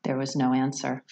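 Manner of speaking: ordinary delivery, nothing marked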